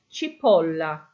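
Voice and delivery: "Cipolla" as chi-POL-la, O stressed. Shown as a demonstration. Teacher repeating "Cipolla" for the class, stressing POL. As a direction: lean on the O in "Cipolla". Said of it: "That's where your stress is on the word".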